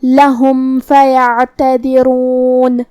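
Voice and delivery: لہم فیعتذرون with the meem clear and no nasal hum. Shown as a demonstration. Teacher demonstrates a clear meem without ghunna. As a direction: slowly say لہم with a clean meem before فیعتذرون. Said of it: "بغیر غنہ سے یہ حکم کو ادا کریں گے".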